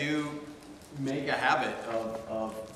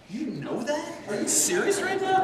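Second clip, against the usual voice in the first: very high, squeaky mock disbelief; hammed-up amazement, pitch shooting way up; incredulous; 5 dB louder than usual. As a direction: act out being astonished by a friend. Retold amazed reply to Mark.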